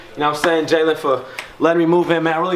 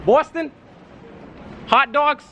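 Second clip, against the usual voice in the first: Boston hype guy; 6 semitones higher than usual. Acted. Boston hype chant character.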